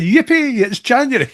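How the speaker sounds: high, loud mock-gleeful squeal; gleeful kid. Host teasing guest as a giddy fourteen-year-old, squealing high. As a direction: play a giddy schoolkid skipping down the corridor. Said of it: "skips down the corridor and saying".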